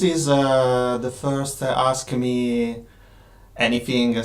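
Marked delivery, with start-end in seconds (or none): none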